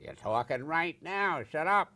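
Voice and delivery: loud, angry shout at a heckler; high, loud scolding; retold angry shout. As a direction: bark it like a furious workshop leader. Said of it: "Now, I'm angry".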